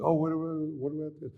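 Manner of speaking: high, eager fan voice; fan pestering. Retold or imitated. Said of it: "they're just".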